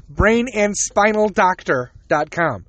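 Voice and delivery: "Brain and spinal doctor dot com" said slowly, each word set apart; slow careful plug. Shown as a demonstration. Giving listeners the surgeon's website, slow and spelled out. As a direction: read the web address out deliberately. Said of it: "Spelling out doctor".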